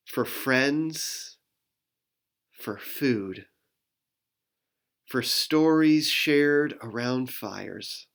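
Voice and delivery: slow, gentle prayer voice; closing prayer. Acted. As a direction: pray it slowly and gratefully.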